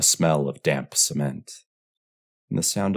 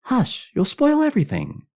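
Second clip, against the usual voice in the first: put-on very high little girl's voice; high-pitched girlish reproach; girl's scolding hush; 6 dB louder than usual. A narrator's reading. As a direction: shush a spoilsport as a girl would. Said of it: "some girl said".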